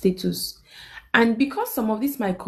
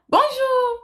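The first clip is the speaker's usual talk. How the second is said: much higher, louder sugary bonjour; fake smiling greeting; 4 dB louder than usual. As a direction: greet her with a forced fake smile. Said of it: "You know that fake smile".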